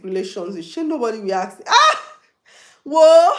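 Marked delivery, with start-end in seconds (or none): 1.7-3.3 a sudden high, loud ah-whoa; hammed-up scandalised exclamation; mock shocked gasp.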